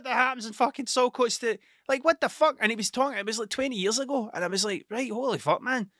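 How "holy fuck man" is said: ordinary delivery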